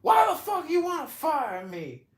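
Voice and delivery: very high, loud put-on shout; hammed-up high-pitched yelling protest; 1 shouting fired guy. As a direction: yell his outrage in a high squeaky voice. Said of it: "And he said".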